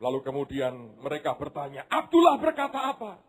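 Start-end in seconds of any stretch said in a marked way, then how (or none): 1.9-3.2 eager question. Acted. Family asks eagerly.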